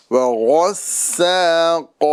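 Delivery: وغسا with a heavy غ and thin س; tajweed contrast; thin seen, heavy ghain. Shown as a demonstration. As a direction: make the غ full and the س light. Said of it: "غین اور قاف، اس کے درمیان سین، اس کو باریک".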